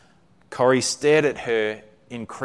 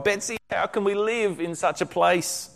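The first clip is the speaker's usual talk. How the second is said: despairing plea; 8 semitones higher, 4 dB quieter than usual. A narrator's reading. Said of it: "Corrie wailed".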